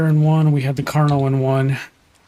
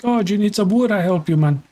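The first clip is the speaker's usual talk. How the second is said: high-pitched hammed-up Latin accent for the beaver; cartoonish Latin-accented beaver offering help; poor Latin accent; 5 semitones higher, faster than usual. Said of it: "this beaver speaks with a very poor Latin accent".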